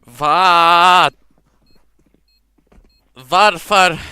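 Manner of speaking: high loud despairing yell in Swedish; shouted Swedish. Pretend. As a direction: wail the question in Swedish. Exaggerated Swedish outburst after dying.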